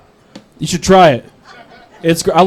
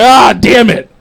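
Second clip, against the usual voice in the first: higher, exasperated mock outburst; angry outburst; 4 semitones higher, 3 dB louder, slower than usual. Dieter beaten by a TV patient, mock-angry curse. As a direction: burst out on a drawn ah, mock angry. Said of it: "I got so angry".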